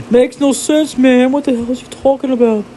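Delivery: high put-on heckler voice; squeaky, incredulous side character; baffled onlooker. Plays onlooker commenting on himself.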